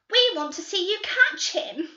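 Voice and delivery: put-on high, loud and giggling girl's voice; eager girl. Girl begging the detectives to watch, high and giggling. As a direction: laugh with eager glee.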